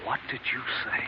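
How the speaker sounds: breathless, voiceless, barely audible; stunned whisper. Acted. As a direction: whisper it as the word sinks in. Hears doctor call him killer.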